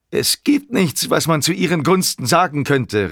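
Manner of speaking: high, emphatic outcry; calling out. A narrator's reading. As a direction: declare it with full defiance. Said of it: "rief er".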